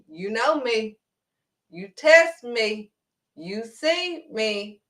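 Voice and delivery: normal talking voice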